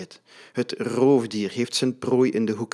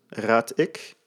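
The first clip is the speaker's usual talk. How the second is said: ordinary delivery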